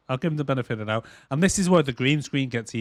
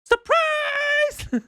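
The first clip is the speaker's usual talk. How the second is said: high squealing surprise shout. Pretend. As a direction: yell it like jumping out at a party.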